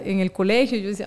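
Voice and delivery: ordinary talk, nothing marked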